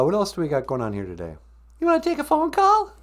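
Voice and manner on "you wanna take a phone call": very high little helper voice; high-voiced sidekick. Sidekick offering host a phone call, very high voice. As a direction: chirp the question way up high.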